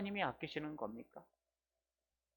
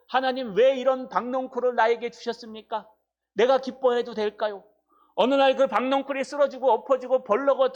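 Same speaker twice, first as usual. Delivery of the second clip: raised, loud preaching voice, higher and louder; fervent preaching; 6 semitones higher, 5 dB louder than usual. Acted. Pastor urging the congregation, preaching loud and high. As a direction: preach it with full force to the congregation.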